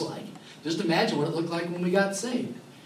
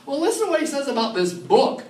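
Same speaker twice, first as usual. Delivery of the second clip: laughing; 11 semitones higher, 5 dB louder than usual. Real, not acted. Preacher laughing with delight.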